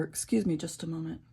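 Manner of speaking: ordinary talk, nothing marked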